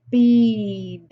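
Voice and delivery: "Speed" stretched sound by sound; slow, blended "Speed" for a phonics class; word on a curve. Shown as a demonstration. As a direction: draw out the s and long ee in "Speed". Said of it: "The word on the curve is speed".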